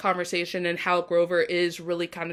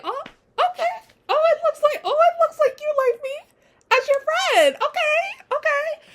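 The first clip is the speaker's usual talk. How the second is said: very high-pitched giddy squeal; high mock-screaming reaction; retold fangirl squealing. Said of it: "Amber and I were screaming".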